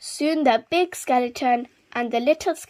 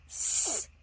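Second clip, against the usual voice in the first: hiss; 9 dB quieter than usual. Acted. Angry animals chase him, hissing cat.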